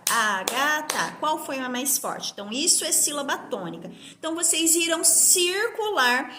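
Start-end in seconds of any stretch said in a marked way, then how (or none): 0.1-1.2 "Ágata" slowly broken into Á, ga, ta; "Ágata" spaced out in three slow, lower beats; separated syllables. Shown as a demonstration. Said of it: "na hora que eu separo".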